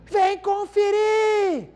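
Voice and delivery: high, slow, drawn-out shout; child's shout. Retold or imitated. Little son shouting to dad from the bathroom, high drawn-out yell. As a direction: bellow it so the whole street hears.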